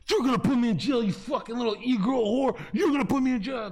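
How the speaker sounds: high, loud reenacted yelling; acted-out shouting in a row; angry accusation. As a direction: hurl it at her like an accusation. Retold shouted accusation at girlfriend.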